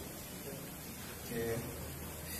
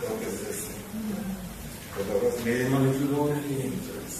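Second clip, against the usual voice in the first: scripture recitation. Acted. Mourner reciting a psalm for his late teacher, measured. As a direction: recite it like a Sunday school lesson.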